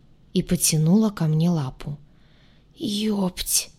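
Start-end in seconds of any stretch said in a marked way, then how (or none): 2.7-3.7 very quiet, choked whisper; strangled whisper. A narrator's reading. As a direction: squeeze it out in a whisper. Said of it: "совсем тихо и сдавленно".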